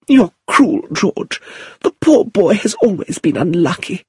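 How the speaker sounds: very high plaintive protest; wounded, fretful aunt. A narrator's reading. Lucilla defending her poor boy, wounded. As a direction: wail it high in his defence.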